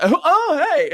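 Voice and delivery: high, excited hey; imitating a cheery greeting; mock greeting. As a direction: greet an old friend with delight. Greeting Jason.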